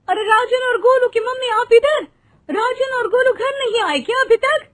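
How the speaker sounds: high-pitched alarmed questioning; worried mother. Acted. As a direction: ask anxiously, pitched right up.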